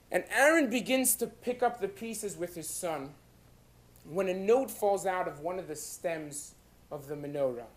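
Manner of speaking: ordinary delivery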